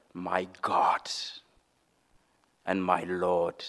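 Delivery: whispered low and soft in awe; awed whisper. Acted. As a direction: breathe it out, overwhelmed. Thomas's awed whispered confession.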